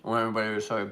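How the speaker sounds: talking through a mouthful of candy; chewing while speaking; mouth full. Real, not acted. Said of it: "I'm talking with my mouth full".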